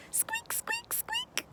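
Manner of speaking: tiny, very high whispered squeaks; mouse squeak. Pretend. Guide squeaking like a tiny mouse for children, very high whisper. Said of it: "What does the mouse say?".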